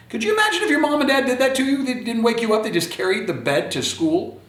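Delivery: high, fast disbelief; amazed. Real, not acted. Reader marvels to children.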